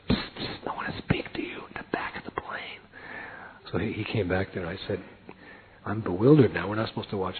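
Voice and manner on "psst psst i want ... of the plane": hushed secret whisper. Retold or imitated. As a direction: whisper it so nobody hears. Secretly calling friend on plane.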